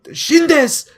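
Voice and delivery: high, loud shout; anime death cry. Pretend. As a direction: shriek it like an anime bystander. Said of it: "I could go".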